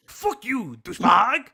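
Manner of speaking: loud, indignant mock French; French accent. Reads insults from a post in a loud French accent. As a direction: rant in a hammed-up French accent. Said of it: "my French accent".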